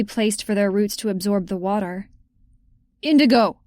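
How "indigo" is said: loud call across the slope; shouted name. A narrator's reading. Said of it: "he shouted after a minute".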